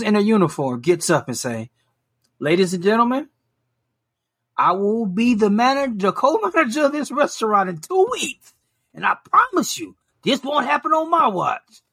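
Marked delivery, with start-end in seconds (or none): 2.4-11.8 lightly mocking formal speech, rising higher toward the end; a hint of a pompous would-be manager; grand announcement. Quoting worker's grand announcement.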